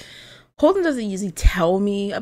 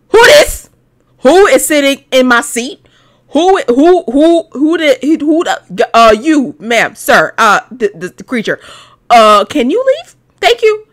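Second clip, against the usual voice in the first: higher and louder, outraged; indignant outburst; 6 semitones higher, 9 dB louder than usual. Acted. Player outraged a girl sits in her seat, higher and louder. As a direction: sputter at the intruder in your seat.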